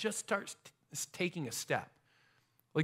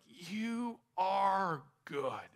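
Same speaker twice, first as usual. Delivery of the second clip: very slow, reverent awe; awed prayer; much slower than usual. Retold or imitated. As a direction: draw each word out in wonder at God. Retells awed prayer on glacier.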